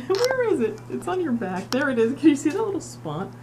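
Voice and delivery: pet talk. Real, not acted. Talking to cat about food spot.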